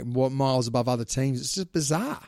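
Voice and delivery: usual speaking voice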